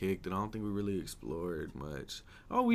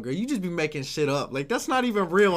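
loud, high-pitched protest; retold exasperation; 8 semitones higher, 8 dB louder than usual. Telling her she's making shit up, exasperated retort. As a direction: call her out in mock exasperation.

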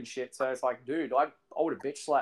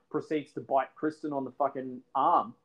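normal talking voice

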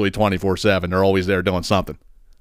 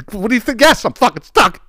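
much higher and louder exasperated comeback; sarcastic retort; 11 semitones higher, 4 dB louder than usual. Retold or imitated. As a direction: snap back at the dumb question. Sarcastic retort to obvious question.

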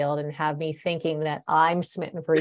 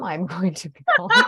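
laughing mock threat; 2 semitones higher, 7 dB louder than usual.

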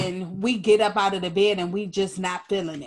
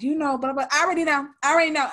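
higher, louder mock teen brush-off; mocking daughter; 6 semitones higher, 3 dB louder, much faster than usual. Mother mimicking her daughter brushing her off. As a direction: toss it off like a know-it-all kid. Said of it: "I was like".

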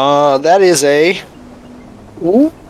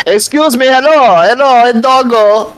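higher, louder call into the dark; yelling out; 7 semitones higher, 4 dB louder than usual. Acted. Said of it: "I'm just gonna yell out into the darkness".

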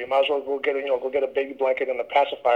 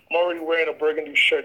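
normal talking voice

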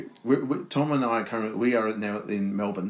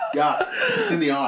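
high-pitched laughing through the words, grossed out; cracking up in real disgust. Real, not acted. Cohost cracks up at the poo-in-the-eye story, laughing high.

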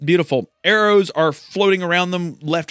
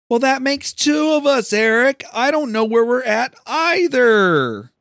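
excited; 9 semitones higher than usual. Real, not acted. Answering character, confused.

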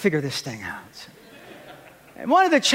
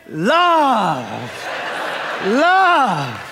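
loud, swooping, drawn-out cry; exultant exclamation; 5 semitones higher, 4 dB louder, much slower than usual. Acted. Preacher announcing what the wife felt, loud and exultant. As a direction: belt each word out, high then falling.

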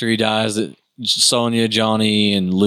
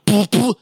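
high poof sound effect; 10 semitones higher than usual. Retold or imitated.

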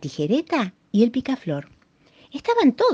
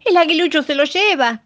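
higher, louder alarmed call; dove shouting; 10 semitones higher, 7 dB louder than usual. A narrator's reading. Dove calling out as the bird is taken, loud cry. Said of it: "gritaba la paloma".

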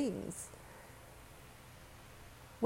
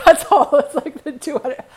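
much higher and much louder, words squeezed out through a laugh; laughing hard; 7 semitones higher, 14 dB louder than usual. Real, not acted. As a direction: burst out laughing at your own line. Laughing at her own words.

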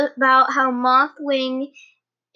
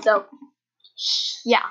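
ordinary talk, nothing marked

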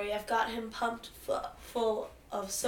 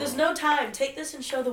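louder, higher urgent cry; high, loud urgent plea; 4 semitones higher, 6 dB louder than usual. Acted. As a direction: push them out, no time left. Urgent farewell in collapsing zone.

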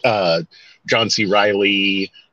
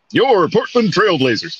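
booming sports announcer; radio announcer voice; 8 semitones higher than usual. Pretend. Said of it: "that radio announcer voice".

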